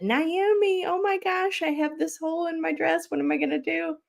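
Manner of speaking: high, anxious rush of a panicked plea to a friend. Retold or imitated. Backstage to her friend about a dress hole, high and panicked. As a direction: pitch it up like a flustered friend in a wardrobe crisis. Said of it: "and I said".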